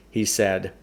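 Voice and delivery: usual speaking voice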